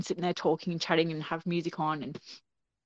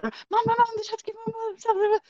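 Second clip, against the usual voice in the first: very high, urgent calls of Mum; a panicked kid shouting up the stairs for help; calling for mum. Retold or imitated. Kids tumbling downstairs calling to mum, high panicked yell.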